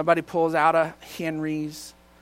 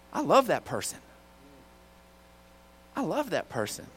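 lightly voiced gentle check, softer; Holy Spirit; 3 semitones lower, 8 dB quieter than usual. Checking the irritated driver, quietly firm. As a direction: softly correct him, leaving space between repeats. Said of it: "the Holy Spirit just checks me and says".

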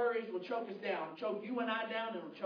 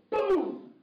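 mock boom; 10 semitones higher, 8 dB louder than usual. Imitating gunshot in story.

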